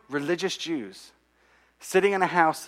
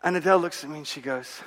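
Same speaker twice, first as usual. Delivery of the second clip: ordinary talk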